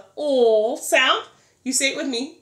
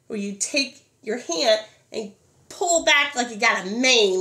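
ordinary talk, nothing marked

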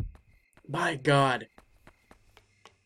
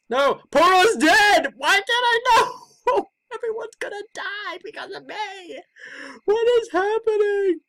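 wailing despair; 14 semitones higher than usual. Real, not acted. Gamer wailing that everyone will die. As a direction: draw it out in hammed-up despair. Despairing over dog's death.

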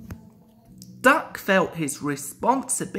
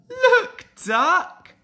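high, loud excited call; 13 semitones higher, 7 dB louder than usual. Acted. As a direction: call him over, thrilled. Crew laughing at the egg.

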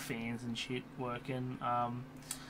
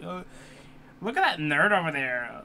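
nerd voice; 3 semitones higher, 7 dB louder than usual. Retold or imitated. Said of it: "That's a nerd voice".

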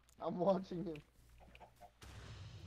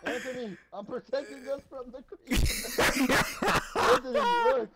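much higher and louder, laughing panic; panicking gamer; 7 semitones higher, 6 dB louder than usual. Real, not acted. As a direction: yelp for help mid-laugh. Creeper attack, laughing and calling.

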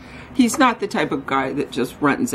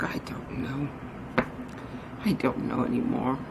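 quiet sad; 4 semitones lower, 10 dB quieter than usual. Real, not acted. Troubled about Jules, trailing off.

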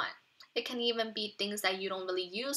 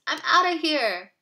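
panicked; 6 semitones higher, 9 dB louder than usual. Acted. Scared boy runs off.

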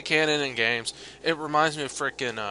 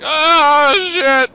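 higher, louder mock panicked yell; hammed-up panicked cry, higher and louder; 12 semitones higher, 12 dB louder, slower than usual. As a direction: squeal it like a panicked enemy being grabbed. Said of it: "They're like".

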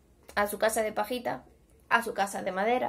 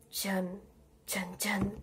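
suspense whisper; 4 semitones lower, 10 dB quieter, much slower than usual. A narrator's reading. Suspense before the wolf.

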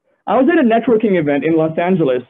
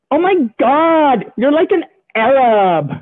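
put-on higher, slow, drawn-out gasp of a ditzy girl; mock valley girl; 6 semitones higher, slower than usual. Club girl reacts to his Saudi answer, slow and mock-amazed. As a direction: stretch the amazement, hammed-up. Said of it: "To which she responds".

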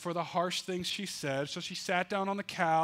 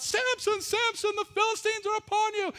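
high, loud cry of alarm; yelled alarm; 6 dB louder than usual. Acted. Wife yelling to wake Samson, loud and high-pitched. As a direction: shout his name as an alarm. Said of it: "she yelled out".

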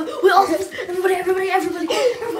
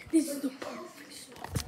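slow hushed whisper; whispering while hiding; 8 dB quieter, slower than usual. Real, not acted. Hiding from the counter.

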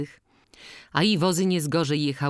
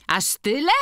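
mildly exclaimed surprise, pitched high; exclamation; 8 semitones higher, 4 dB louder than usual. A narrator's reading. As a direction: exclaim in higher-voiced astonishment. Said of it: "wykrzyknęła organiścina". (okrzyk lekkiego zdziwienia)

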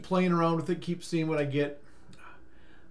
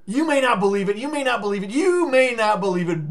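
higher, louder teasing repeat; teasing build-up; 4 semitones higher, 7 dB louder than usual. Acted. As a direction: tease them before the reveal.

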